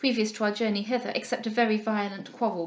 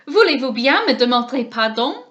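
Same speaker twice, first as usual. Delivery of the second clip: louder, offended French cry; indignant cry; 9 dB louder than usual. A narrator's reading. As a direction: demand satisfaction, loudly. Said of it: "cried Monsieur Dubois".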